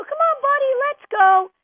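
high, bright call to a dog; loud, upbeat come-along call; coaxing the dog. Acted. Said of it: "you go".

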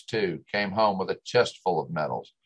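plain reading voice

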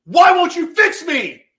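very high, very loud anguished scream, slow; desperate furious scream at God. Retold or imitated. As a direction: scream it up at heaven. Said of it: "as I'm cursing at God".

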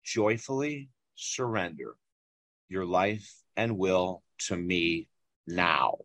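low, slow, solemn divine voice; quiet, measured command from an unseen voice; mysterious inner voice. Retold or imitated. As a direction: intone it slowly and softly, word by word. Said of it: "I hear this voice and it says".

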